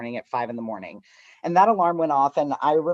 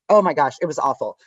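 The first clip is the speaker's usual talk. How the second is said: ordinary talk, nothing marked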